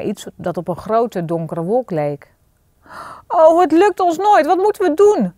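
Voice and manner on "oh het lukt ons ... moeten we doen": high, loud despairing wail; panicky, pitched-up despair; desperate cry. A narrator's reading. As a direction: cry out as if all is lost. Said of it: "riep Marie wanhopig".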